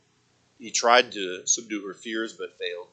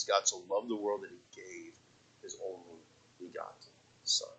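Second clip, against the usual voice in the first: reverent hush; 9 dB quieter than usual. Acted. Soft reverent scripture reading.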